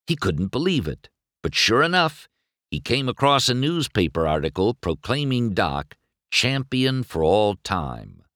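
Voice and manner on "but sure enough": usual speaking voice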